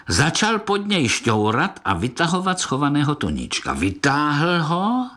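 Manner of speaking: usual speaking voice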